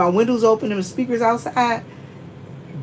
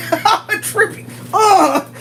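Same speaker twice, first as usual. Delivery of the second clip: high, giggling mock threat; laughing threat; 9 semitones higher than usual. Real, not acted. Warning friends she'd trip them to escape, laughing. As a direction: tease while laughing.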